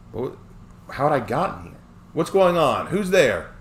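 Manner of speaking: high, loud alarmed call. Acted. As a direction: call out in the dark, scared. Alarmed calling out.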